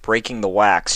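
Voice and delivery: ordinary delivery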